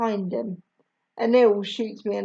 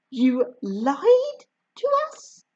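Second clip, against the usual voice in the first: slow, higher hurt question; mildly stunned, stretched out; surprised and hurt; 5 semitones higher, slower than usual. A narrator's reading. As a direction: ask it in wounded disbelief. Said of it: "sounding surprised and hurt".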